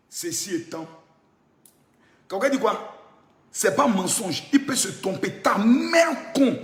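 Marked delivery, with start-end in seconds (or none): 5.3-6.6 very slow, stretched word; drawn-out insult. Real, not acted. Cursing the rival's mother, slowly drawn out. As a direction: stretch the insult out in one long sweep.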